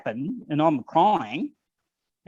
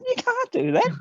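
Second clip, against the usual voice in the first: high startled protest; 4 dB louder than usual. Retold or imitated. Protesting to the corporal, retold.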